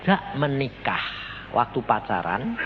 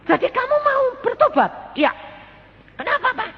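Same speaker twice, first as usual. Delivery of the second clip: much higher, amazed questions; stunned husband; 4 dB louder than usual. Retold or imitated. Husband amazed his wife wants to repent, much higher.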